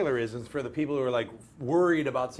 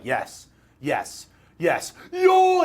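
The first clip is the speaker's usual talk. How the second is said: excited reaction; 3 semitones higher, 8 dB louder, much slower than usual. Retold or imitated. Imitating an actor watching his own trailer, excited. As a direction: build from plain yeses to a high shout. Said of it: "And Boyega's like".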